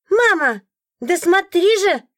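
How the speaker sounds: put-on high, loud kid tugging for attention; high-pitched, impatient child's voice; demanding child. A narrator's reading. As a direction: pipe up like an insistent little boy. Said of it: "требовательный детский голос".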